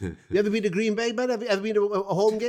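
high put-on Valleys Welsh accent; Pontypridd-Merthyr accent. Packers fan asking about Green Bay, in a high Pontypridd-Merthyr accent. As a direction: ask eagerly in high-pitched Pontypridd. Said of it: "I'll do Miss Pontypridd, uh, slash Merthyr".

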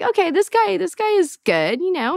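high-pitched, won-over realization; impressed, high inner voice; retold delighted approval. Replaying growing approval of the date.

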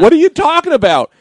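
high, loud baffled question; bewildered. Retold or imitated. High guy to his scene partner, bewildered. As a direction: demand it in total confusion.

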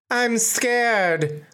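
put-on high, eerie horror-movie kid; spooky little-girl mimicry; creepy child. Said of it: "Like, really weird, like Children of the Corn shit".

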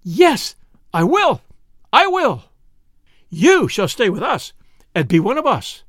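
high, loud, emphatic outburst; excited, wholehearted promise; fervent exclamation. A narrator's reading. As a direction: burst out with joyful resolve, then ease down.

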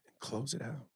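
soft jazz DJ echo; radio voice. Pretend. Co-host finishing the host's line, soft radio voice. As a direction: murmur it like a late-night co-host. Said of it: "in your radio voice".

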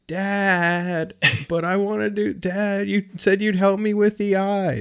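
put-on high-pitched pleading child voice; imitated kid. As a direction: plead with Dad like a little boy. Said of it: "interacting with the kid".

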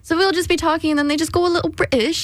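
British accent. Shown as a demonstration. Co-host showing how kids talk, playful British accent. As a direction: chatter in a teenager's mock British accent. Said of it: "I can do, like, a British accent".

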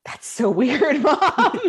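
put-on high, laughing kid voice; mock daughter. Daughter answering her mom, high and laughing. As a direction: play the bemused little girl.

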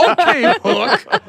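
high laughing voice. Real, not acted. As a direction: laugh through it.

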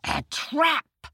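big tiger roar. Acted. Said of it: "roared the tiger".

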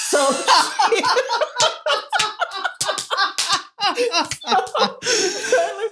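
long high cackling laugh; helpless high laughter; real laughing fit. Real, not acted. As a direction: crack up, then try to resume. Cracking up at own joke.

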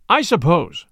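ordinary delivery

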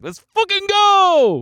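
loud, high, drawn-out victory yell; all-out celebratory shout; triumphant cheer. Retold or imitated. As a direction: cheer like your team just scored. Retold cheer when baby tracks card.

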